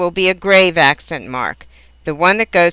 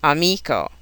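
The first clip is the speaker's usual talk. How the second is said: "amico" as a-MI-co; stress second syllable; 2 semitones lower, 5 dB quieter than usual. Shown as a demonstration. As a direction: lean on the MI of "amico". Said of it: "on the second syllable".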